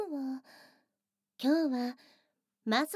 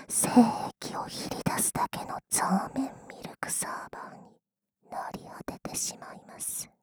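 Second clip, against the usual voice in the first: whispering; 2 semitones lower than usual. Acted. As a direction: close breathy whisper in the ear. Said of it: "耳元でささやかれても".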